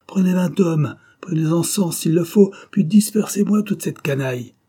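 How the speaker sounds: high, loud angry commands; furious commander shouting down the line; barked orders. A narrator's reading. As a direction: order troops to clear the rabble. Commander barking orders by phone.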